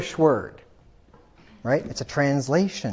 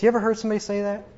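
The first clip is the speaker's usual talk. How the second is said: ordinary delivery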